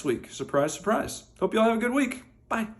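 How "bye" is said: normal talking voice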